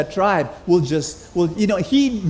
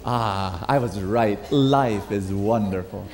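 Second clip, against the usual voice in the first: satisfied sigh; 9 semitones lower, 4 dB quieter, slower than usual. Pretend. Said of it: "you say".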